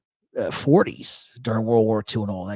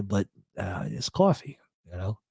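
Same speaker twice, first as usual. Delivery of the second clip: ordinary talk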